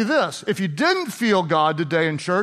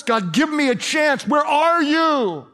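higher, drawn-out shouted plea; desperate cry; 6 semitones higher, slower than usual. Acted. Paul crying to God after years of waiting, desperate. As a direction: call out to an absent God, stretching the last words.

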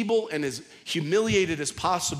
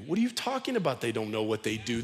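usual speaking voice

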